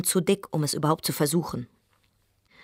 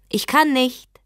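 higher, louder cry; calling out; 8 semitones higher, 7 dB louder, slower than usual. Acted. Wife calling to her husband through the door she won't open. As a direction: call out the refusal.

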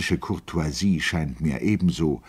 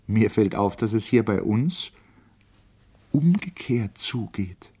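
put-on raised, lively older lady; Lotte's voice; 7 semitones higher than usual. A narrator's reading. Lotte teasing Goethe about Du and Sie, in a raised lively voice. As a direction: speak up brightly as an old lady teasing him.

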